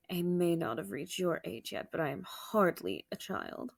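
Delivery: normal talking voice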